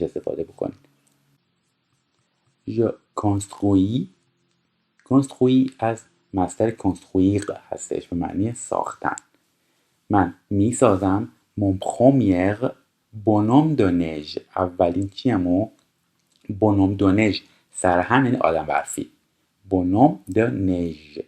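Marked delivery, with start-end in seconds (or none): 2.7-4.1 very slow, careful French reading; slow, clear dictation. Shown as a demonstration. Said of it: "teacher reads French slowly". 11.6-14.4 very slow, careful French reading; slow, clear dictation. Shown as a demonstration. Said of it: "teacher reads French slowly". 19.7-21.2 Bonhomme de neige drawn out very slowly, syllable by syllable; slow dictation of Bonhomme de neige; slow model reading. Shown as a demonstration. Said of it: "repeated very slowly".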